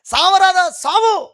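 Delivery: high, loud spiteful jeer; scornful wife. Retold or imitated. Job's wife taunting him to die, high scornful jeer. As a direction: spit the words out like a bitter wife. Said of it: "అని అంటుంది".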